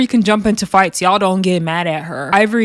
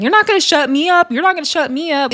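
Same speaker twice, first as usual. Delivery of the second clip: higher-pitched mock defiance; defiant retort; 7 semitones higher than usual. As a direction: act out a defiant comeback. Retold argument, Scotty snaps back.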